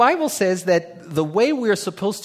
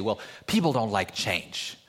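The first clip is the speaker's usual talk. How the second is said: mocking; 7 semitones lower than usual. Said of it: "people always say".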